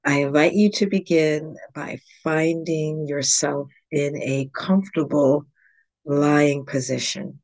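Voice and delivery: calm, measured meditation guide with long pauses; guided yoga nidra. Real, not acted. Yoga teacher guiding listeners into yogic sleep, calm meditation voice.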